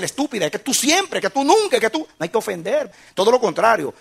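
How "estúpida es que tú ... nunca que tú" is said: mock insult. Shown as a demonstration. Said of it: "No hay que decir".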